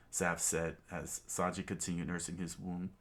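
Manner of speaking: usual speaking voice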